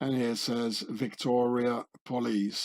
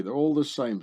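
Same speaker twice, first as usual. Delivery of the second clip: usual speaking voice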